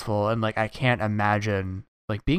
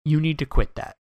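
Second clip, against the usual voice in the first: stern teacher order; 6 semitones higher, 5 dB louder than usual. Retold or imitated. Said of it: "And she's like".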